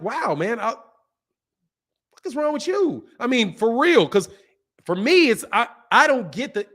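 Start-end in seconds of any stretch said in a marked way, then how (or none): 0.0-3.1 slow, drawn-out disbelief, pitch up; can't believe how rude she is; incredulous. Retold or imitated. Telling a snotty celebrity off in disbelief. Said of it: "I was like".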